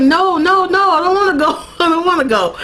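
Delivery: high mock-distressed whine; Sookie. Sookie refuses to go into the water, mock distress. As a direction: plead in a high, hammed-up protest. Sookie protests leaving the dream.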